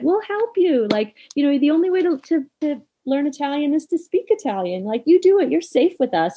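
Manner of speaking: high, bright encouragement, imitating kind friends; reassuring friends. Dog trainer friends at dinner reassuring her, high and encouraging. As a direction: coax her gently to just try. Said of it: "such a safe response".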